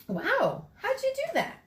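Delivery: put-on high, amazed young boy's voice; a wide-eyed kid impressed by a trick; awed kid. Andres marveling at Gino's fast cube solving, high and amazed. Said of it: "asked Andres".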